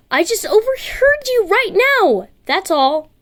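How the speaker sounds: high, defensive little voice; flustered boy. Acted. Embarrassed boy explaining he only overheard, high voice. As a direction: protest your innocence, embarrassed.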